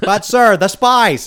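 high, loud nasal over-the-top voice; exaggerated nasal Lynch delivery; David Lynch voice. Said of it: "He always talks like this".

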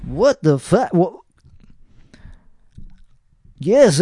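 ordinary talk, nothing marked

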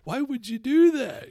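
high, loud, slow incredulous cry; mock outrage. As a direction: throw up your hands at the rogue, high and loud. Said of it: "I'm just like".

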